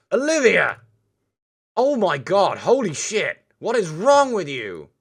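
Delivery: loud, high outraged yelling; shocked shout. Real, not acted. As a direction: yell at her in disbelief. Scolding friend after insult.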